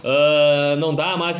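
usual speaking voice

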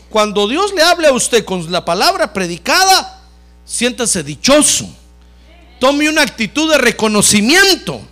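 high, loud exhortation from the pulpit; impassioned preaching. Real, not acted. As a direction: preach it at full force, pitch climbing steadily. Preaches at full force. (predicación enérgica, alta y fuerte)

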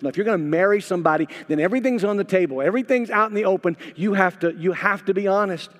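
loud, fast, high insistent preaching; urgent sermon build; preaching at full force. Acted. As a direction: drive the point home to the couple.

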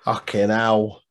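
ordinary talk, nothing marked

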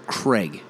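CRAIG as "Creg"; short E in Craig; American pronunciation. Shown as a demonstration. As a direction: Craig like "egg". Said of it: "they'll say Craig".

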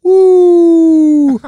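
high, loud wrestler whoop; Ric Flair woo. Retold or imitated. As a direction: let out a big showman's woo. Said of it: "Ric Flair".

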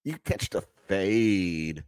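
slow, drawn-out Valley girl imitation; mock Valley girl. Host mocking the woman in the video, imitated Valley girl drawl. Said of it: "Talking like a fucking Valley girl".

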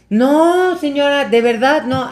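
high, loud mock protest; pleading youth, imitated. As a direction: swear innocence to an angry lady. Youth pleading with angry mother. (súplica aguda y exagerada, imitada)

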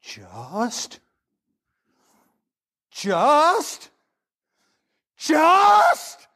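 repeating just, climbing to a shout; incredulous professor shout. Retold or imitated. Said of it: "Traina goes".

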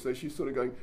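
ordinary talk

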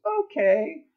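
very high, loud little "Okay"; cartoon lion. The lion answering the elephant, very high and loud. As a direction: squeak it like a delighted cartoon lion. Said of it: "Lion goes".

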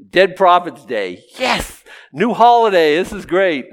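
high, loud gloating cheer; mock celebration. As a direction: cheer it like a gleeful new holiday. Mimics earth dwellers celebrating new holiday.

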